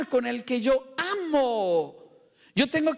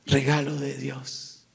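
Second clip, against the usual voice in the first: low, weighty answer; solemn affirmation; 9 semitones lower than usual. Shown as a demonstration. Preacher answers what a daughter is, solemn affirmation. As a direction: drop deep and state it firmly.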